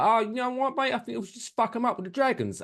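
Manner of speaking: jokey Cockney, quick and matey; Cockney accent. Acted. Dave advising the royal court about dragons, jokey Cockney. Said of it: "some random Cockney accent".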